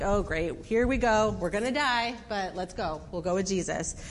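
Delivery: low, grudging acceptance; mildly weary, going along reluctantly; imitating a reluctant follower; resigned. Thomas grudgingly agrees to go.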